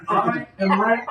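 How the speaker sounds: high, loud royal announcement; king's proclamation. Acted. Crowned leader answering who he is, high loud proclamation. Game master answers as the king.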